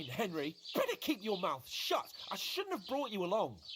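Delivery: usual speaking voice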